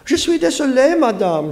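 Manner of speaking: put-on high-pitched girl apologizing in French; French-speaking girl. A narrator's reading. French-speaking girl, voiced in story.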